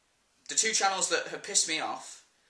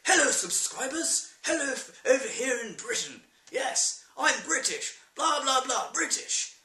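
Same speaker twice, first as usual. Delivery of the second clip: high, hammed-up British accent; chirpy over-Englishness, sent up; mock British vlogger; 9 semitones higher than usual. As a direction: parody a gimmicky British YouTuber. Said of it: "every video they're like".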